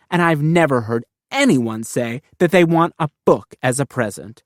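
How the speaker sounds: plain reading voice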